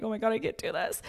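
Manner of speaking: quiet distressed. Retold or imitated. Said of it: "And I'm like".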